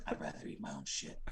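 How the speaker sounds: low hoarse whisper; whispered mockery. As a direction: breathe it out gruffly. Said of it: "he's like".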